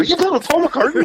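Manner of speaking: high, astonished question; amazed. Retold or imitated. [name] reacting to [name]'s [name] story, high and amazed. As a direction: squeal it in disbelief.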